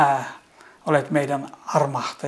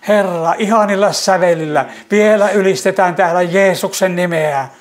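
raised, louder and higher, swelling praise; fervent praise; 4 semitones higher, 4 dB louder than usual. Real, not acted. Praying for the weary, rising into fervent, louder praise. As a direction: let the prayer swell into ringing praise.

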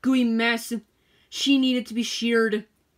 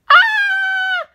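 piercing high terrified scream; sheep's scream; 9 dB louder than usual. A narrator's reading. Sheep waking to spiders on her face, piercing high scream. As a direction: shriek in sudden fright. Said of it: "screamed Violet".

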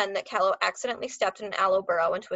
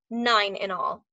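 ordinary delivery

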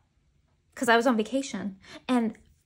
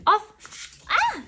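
sharp, high, loud shout of "off" to the cat; a startled, high-pitched yell at the cat; 11 semitones higher, 7 dB louder than usual. Real, not acted. Shooing the cat off.

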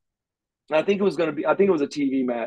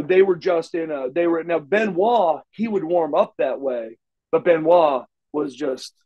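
ordinary talk

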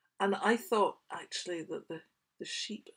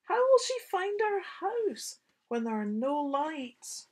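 put-on worried child; 10 semitones higher, 7 dB louder than usual. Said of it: "said Sam".

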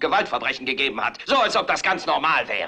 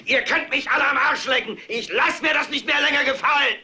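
higher, furious yell; shouted defiance; 8 semitones higher than usual. Acted. Anchor tells the viewers what to say, shouted. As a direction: hurl the slogan out. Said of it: "Ihr müsst sagen".

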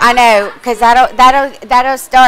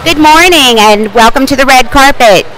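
upbeat live TV host; bright broadcast welcome, louder; red carpet host; 5 dB louder than usual. Acted. Second take of broadcast intro.

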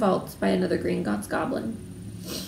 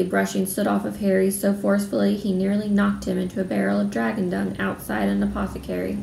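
plain reading voice

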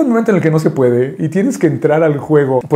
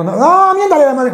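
eager urging; 11 semitones higher than usual. Retold or imitated. Old man urging him.

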